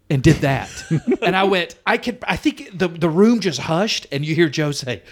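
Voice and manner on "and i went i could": normal talking voice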